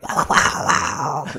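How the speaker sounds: big unvoiced laugh; loud burst of laughter. Real, not acted. Laughs at roaring timer.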